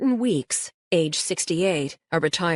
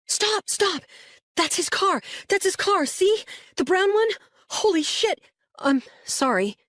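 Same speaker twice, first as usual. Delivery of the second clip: high excited yell, pitched way up; yelling boy; 14 semitones higher than usual. Acted. Boy spots the suspect's car and yells out the window. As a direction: shout it, thrilled and startled. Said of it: "He yells".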